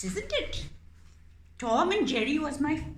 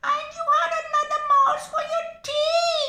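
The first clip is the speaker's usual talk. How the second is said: much higher, loud squeaky begging; squeaky pleading mouse; 7 dB louder, much faster than usual. Acted. Mouse puppet begging the cat not to eat it, squeaky. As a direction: squeak for your life.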